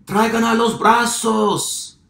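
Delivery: high, loud urgent shout; full-voiced farmer's yell, drawn out. A narrator's reading. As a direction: holler out to the farmhands. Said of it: "gritó el granjero".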